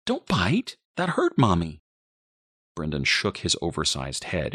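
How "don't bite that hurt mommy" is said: lightly voiced scolding mother, higher pitched. A narrator's reading. Mother tells baby not to bite, mild high scolding. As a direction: scold a little one, pitched up.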